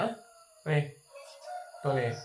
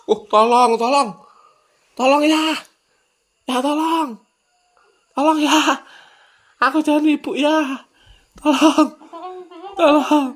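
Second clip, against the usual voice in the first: put-on pleading child voice; 8 semitones higher than usual.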